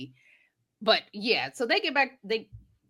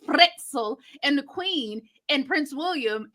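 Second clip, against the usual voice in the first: usual speaking voice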